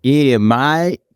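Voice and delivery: exaggerated Aussie, syllables hanging on; drawn-out Australian accent. Shown as a demonstration. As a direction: stretch it out in broad Australian. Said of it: "let 'em hang on for ages".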